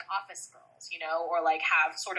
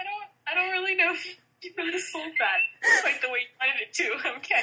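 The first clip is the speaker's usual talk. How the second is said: laughing; 11 semitones higher, 4 dB louder than usual. Real, not acted. Reacting to an awkward pitch.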